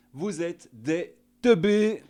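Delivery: mock taunt. Taunting the Russians.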